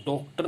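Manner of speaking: डॉक्टर said with a closed o, "dok-tar"; wrong o vowel. Shown as a demonstration. Teacher showing what not to say, डॉक्टर with a plain "o". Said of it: "नहीं बोलेंगे".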